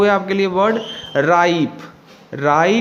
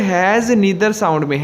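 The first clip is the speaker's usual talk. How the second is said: slow, spelled-out has neither sound; slow emphasis; much slower than usual. Shown as a demonstration. Teacher stressing the column title to students, slowly. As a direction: lay out each word slowly. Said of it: "अब देखिए".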